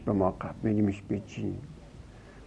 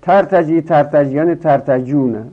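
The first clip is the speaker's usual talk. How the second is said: higher, louder recitation; یرتجی، یرتجیان، یرتجونه called out high and loud; reciting forms; 5 semitones higher, 7 dB louder than usual. Shown as a demonstration. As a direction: announce the forms to the class. Teacher reciting the paradigm.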